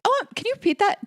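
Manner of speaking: high, fast, fake innocent ask; playing dumb. Shown as a demonstration. Hoping someone insults her, high fake innocent ask. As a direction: act sweetly clueless to corner them. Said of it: "so I can be like".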